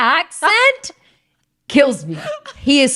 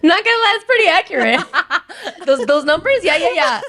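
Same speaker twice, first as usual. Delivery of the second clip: giggly, higher agreement; laughing; 5 semitones higher than usual. Real, not acted. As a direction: chuckle while you agree. Laughing agreement about the poll.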